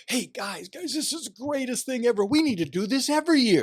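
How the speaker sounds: high, loud, thrilled mock exclamation; ecstatic priest. Imitating the thrilled university president on the team bus, high and loud. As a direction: gush like an overjoyed priest after a big win. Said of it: "He's go".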